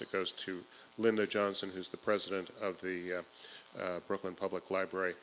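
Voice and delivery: usual speaking voice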